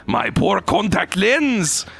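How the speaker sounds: high, slow angry shout in a fake German accent; angry mock German accent. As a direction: scold him furiously in a wobbly German accent. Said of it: "I was trying to do German".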